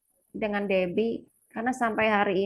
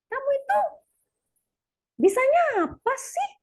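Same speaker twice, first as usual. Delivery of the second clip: put-on high, sharp scolding of a child; scolding outburst; 3 dB louder than usual. Mother blurting a harsh scold at her child, high and sharp. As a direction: snap at the kid in a high voice. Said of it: "Nah, itu keceplos tuh".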